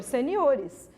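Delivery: usual speaking voice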